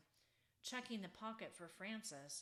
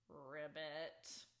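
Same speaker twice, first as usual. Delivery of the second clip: low nervous ribbit; nervous frog croak; 4 semitones lower than usual. A narrator's reading. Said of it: "a low, nervous-sounding croak".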